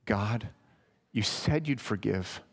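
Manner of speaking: soft, low, slow plea; hushed pleading prayer; humble prayer. Shown as a demonstration. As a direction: lean fully on grace. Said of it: "pray like Amos prayed".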